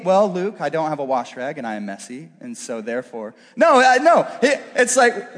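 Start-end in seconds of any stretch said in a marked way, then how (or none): 0.0-3.4 low, soft matter-of-fact dog voice; talking dog. Retold or imitated. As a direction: voice the dog explaining himself, low and subdued. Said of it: "And he would tell me".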